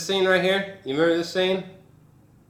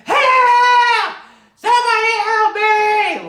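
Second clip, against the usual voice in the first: very high, loud mock shriek; mimicked shrieking; 11 dB louder, slower than usual. Reviewer acting out the scene when he's shrieking, loud high shriek. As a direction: shriek for help in imitation of a desperate victim. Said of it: "when he's shrieking and he's like".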